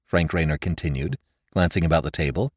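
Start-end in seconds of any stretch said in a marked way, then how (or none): none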